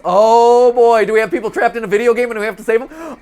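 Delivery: loud, fast excited rush. Real, not acted. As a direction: blurt it out thrilled. Excited gamer starting game.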